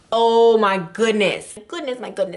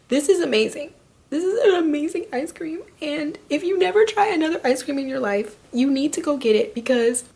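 gushing; 8 semitones higher than usual. Real, not acted. Raving about ice cream.

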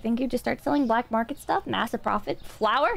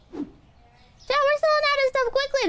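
panicked; 10 semitones higher than usual. Real, not acted. Streamer panicking to chat.

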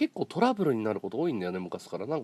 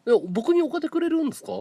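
mock incredulous; 6 dB louder than usual. Mocking the rich boss.

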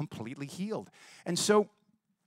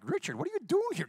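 high, fast surprised question; shocked to see him at class; 13 semitones higher, faster than usual. Retold or imitated. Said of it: "and I'm like".

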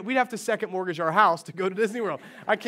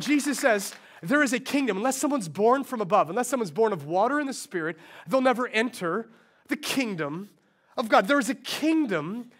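raised, measured sermon delivery; preacher quoting Jesus; preaching; 3 semitones higher than usual. Acted. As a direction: proclaim the kingdom to the crowd. Preaching scripture.

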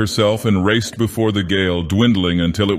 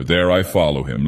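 ordinary delivery, nothing marked

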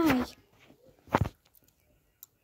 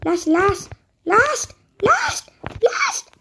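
rising, squealing chant; excited repetition; 3 semitones higher, slower than usual. Real, not acted. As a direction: pitch climbing to a squeal. Excitedly chanting who to kill last.

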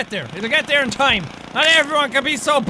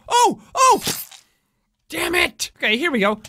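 ordinary delivery

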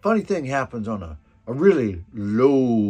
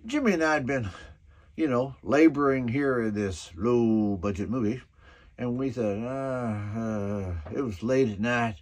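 normal talking voice

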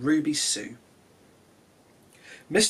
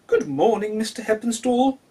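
lady's voice; 7 semitones higher, 4 dB louder than usual. Acted. Doctor's wife voiced.

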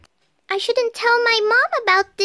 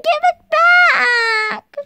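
crying shout; 5 dB louder, much slower than usual. Pretend. Child shouts for pet.

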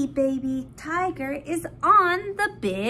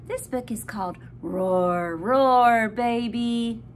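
put-on reading to a toddler; 5 semitones lower than usual. Announces book title.

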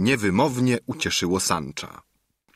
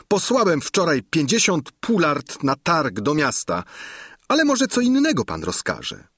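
higher, apologetic innkeeper; innkeeper excusing himself; 7 semitones higher than usual. A narrator's reading. Innkeeper apologizing to Sancho, voiced higher. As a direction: wriggle out of the order politely.

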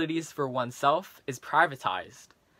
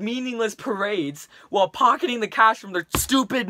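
put-on frustrated teen rant, building louder; Leon, angry rant; 4 dB louder than usual. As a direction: vent at a classmate, getting heated. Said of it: "in the following rant to his classmate".

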